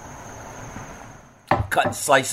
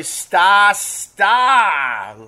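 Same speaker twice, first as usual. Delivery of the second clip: drawn-out Boston "Sta Sta" with dropped r; hammed-up Boston accent, long open vowels; 6 semitones higher than usual. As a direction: stretch it out in mock Boston. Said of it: "That's how they say it in Boston".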